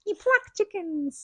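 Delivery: high, slow imitation of grandma; drawn-out, high grandma impersonation; grandmother's voice. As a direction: pitch it up and stretch it like a correcting grandmother. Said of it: "I hear my grandmother's voice".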